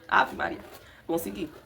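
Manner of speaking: ordinary talk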